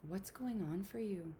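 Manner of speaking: soft, soothing, with long pauses; hushed, caring questions, leaving space to feel; tender self-talk. Shown as a demonstration. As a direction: gently check in with yourself. Models gentle talk to oneself.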